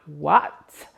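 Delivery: loud, startled "What?"; stunned. Retold or imitated. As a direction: caught off guard with joy. Reacting to the invitation.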